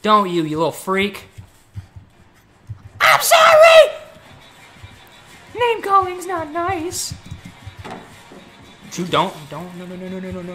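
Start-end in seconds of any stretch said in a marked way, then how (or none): 3.0-4.0 shrieking high apology; high panicked scream. Real, not acted. Panicked apology when hit. 5.5-7.1 high, slow, whiny scold; whiny falsetto. Acted. Whiny scolding after getting hit.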